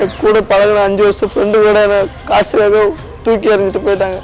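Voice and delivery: high, tearful lament; hurt, wailing complaint; crying. Real, not acted. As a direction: keep talking through the tears. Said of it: "first please அழாதீங்க".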